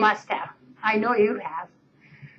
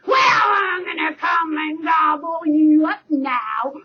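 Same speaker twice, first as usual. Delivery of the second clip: troll roar; 4 semitones higher, slower than usual. Acted. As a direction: growl the threat slowly. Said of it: "roared the troll".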